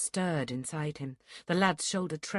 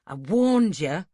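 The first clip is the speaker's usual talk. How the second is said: slow, louder snarled warning; 7 dB louder, slower than usual. A narrator's reading. Duncan scolding the reckless boy with a snarl. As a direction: grind each word out angrily. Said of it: "The words came out in a snarl".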